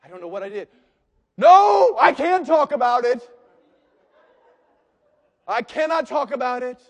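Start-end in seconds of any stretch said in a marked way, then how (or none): 1.4-6.8 loud, high, showy forgiveness; mock magnanimous forgiver. Churchgoer to pastor, hammed-up loud forgiving. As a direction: play the grandly forgiving churchgoer. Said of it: "forgiver refusing to explain".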